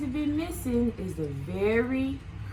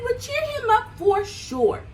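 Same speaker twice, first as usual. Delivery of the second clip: proud Lion; 11 semitones higher than usual. Acted. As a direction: brag about the golden mane. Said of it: "he said".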